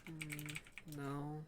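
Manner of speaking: faint, exhausted replies, barely there; weak sick voice. Acted. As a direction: answer like you're too ill to talk. Sick tired character answers weakly.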